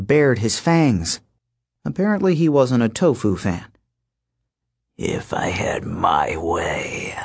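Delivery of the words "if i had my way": low whispered wolf king. Acted. Lycaon speaks.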